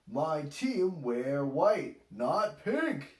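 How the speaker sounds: a touch of a sigh, slowly; sighing Daddy Pig. A narrator's reading. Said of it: "he sighs".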